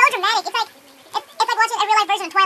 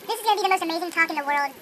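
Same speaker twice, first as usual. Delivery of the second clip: ordinary talk, nothing marked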